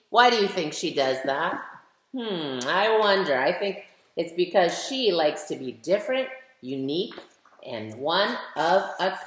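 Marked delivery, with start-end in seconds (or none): none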